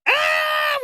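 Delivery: high-pitched drawn-out holler; excited high "ah". Retold or imitated. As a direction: let out a long, high yell of triumph. Re-enacting learning the lunchroom beat.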